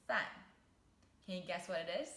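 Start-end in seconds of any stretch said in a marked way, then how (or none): 0.1-0.3 饭 said as a sharp falling fàn; teacher showing viewers the fourth tone on 饭, crisp drop; fourth tone demo. Shown as a demonstration. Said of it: "the fourth tone".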